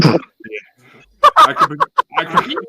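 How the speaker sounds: high, slow words broken by laughter; fighting back a laugh. Real, not acted. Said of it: "I'm trying so hard not to laugh".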